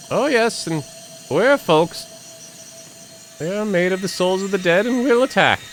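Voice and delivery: lightly voiced game character; voicing a game character. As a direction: read the warning like a brisk guide. Reads game character's lines.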